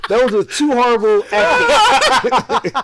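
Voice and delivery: very slow, high and loud Jamaican drawl; mock Jamaican accent. Host mocking the match talk in a slow mock Jamaican accent. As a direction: stretch it out in a hammed-up Jamaican voice. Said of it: "the Jamaican accents".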